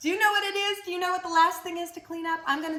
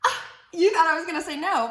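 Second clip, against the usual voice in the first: ordinary delivery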